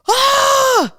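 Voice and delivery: high, loud night-terror scream; imitated scream. Wife imitating husband's night terror, loud high scream. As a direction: cry out as if jolted from sleep.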